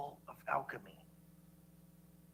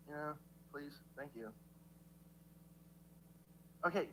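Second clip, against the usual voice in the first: ordinary delivery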